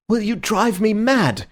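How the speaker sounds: high, slow, desperate plea; desperate cry, pitched high. A narrator's reading. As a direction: cry out at wits' end. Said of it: "he cried".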